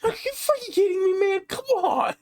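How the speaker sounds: high, incredulous retold outburst; high comic indignation at someone else's luck; mock outraged disbelief. Retelling own outraged disbelief at a pull.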